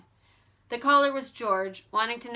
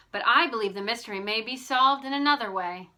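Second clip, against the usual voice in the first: usual speaking voice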